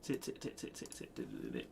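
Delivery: whispered tit tit hi-hat sounds; hi-hat beatbox. Shown as a demonstration. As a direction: tap out hi-hats with the mouth. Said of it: "We gotta get the hi-hats popping".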